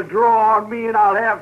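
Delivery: ordinary delivery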